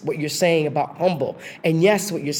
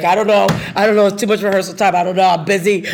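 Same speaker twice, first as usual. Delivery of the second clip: loud reluctant brush-off; 4 semitones higher, 8 dB louder than usual. Retold or imitated. Brushing off the rehearsal invite.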